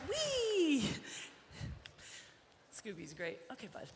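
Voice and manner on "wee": high squeal of joy; playful high voicing of the colt; gleeful horse voice. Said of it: "He's like".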